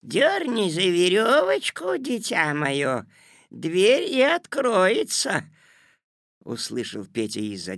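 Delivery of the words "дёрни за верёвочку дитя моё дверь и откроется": high, slow granny singsong; old grandmother. A narrator's reading. Grandmother answering from behind the door, high slow voice. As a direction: call it out high and drawn-out.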